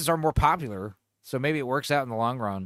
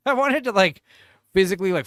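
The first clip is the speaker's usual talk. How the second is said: excited; 5 semitones higher, 6 dB louder, faster than usual. Real, not acted. Excited retelling, laughing.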